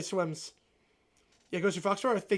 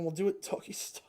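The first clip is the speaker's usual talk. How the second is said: deep sultry voice; 3 semitones lower, 8 dB quieter than usual. Retold or imitated. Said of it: "deep, sultry voice, like [name] saying".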